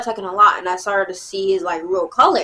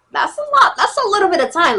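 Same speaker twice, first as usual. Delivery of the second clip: exasperated; 9 semitones higher, 4 dB louder than usual. Retold or imitated. Telling a clingy boy off.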